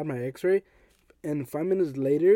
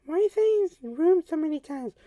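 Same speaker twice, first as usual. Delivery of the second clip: high, louder squeaky mock voice of a listener; 13 semitones higher, 4 dB louder than usual. Viewer teasing the storyteller, high mock voice. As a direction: play a baffled listener, pitched way up. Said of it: "you're probably like".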